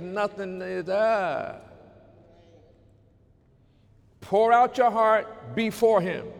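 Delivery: rising to a fervent preaching cry; preaching at full force. Acted.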